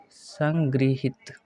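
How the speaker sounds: संगृहित drawn out slowly with ऋ sound; slow model word. Shown as a demonstration. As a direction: stretch संगृहित syllable by syllable. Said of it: "इसका शुद्ध अर्थ होगा". (धीमा उच्चारण: संगृहित)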